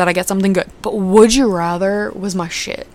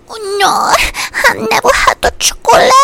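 Bunny Swan; 4 dB louder than usual. Acted. Clueless customer answering candy store clerk, high comic voice. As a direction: play a clueless little woman, pitched way up. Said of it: "she talks like that".

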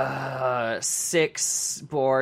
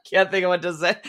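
high loud laugh breaking the bit; cackling out of the bit; helpless laughing fit; 8 semitones higher, 7 dB louder than usual. Real, not acted. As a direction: crack up and lose the character.

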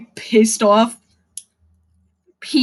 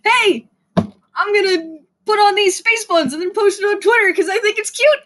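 put-on lightly higher, cheerful kid voice; a hint of a sweet young gamer sharing a photo; innocent kid; 6 semitones higher than usual. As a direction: play the happy kid posting online. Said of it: "they decided".

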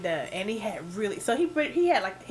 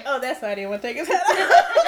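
louder mock quote through laughter; laughing while imitating the reaction; 6 dB louder than usual. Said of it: "me and her like".

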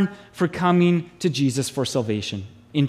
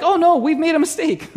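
mock panic; 8 semitones higher than usual. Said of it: "so they're like".

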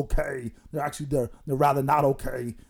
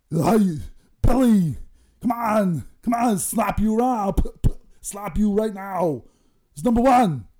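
higher, louder hammed-up scolding; mock scolding; 6 semitones higher, 4 dB louder than usual. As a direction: bark at Billy in a hammed-up rage.

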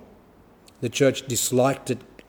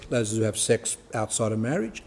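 ordinary talk

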